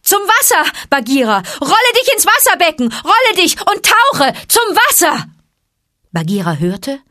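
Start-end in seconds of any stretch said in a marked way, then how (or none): 0.0-5.2 high, loud urgent shout; loud call. A narrator's reading. Mowgli yells to Bagheera to reach the water. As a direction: yell the warning across the fight. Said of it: "rief er mit lauter Stimme".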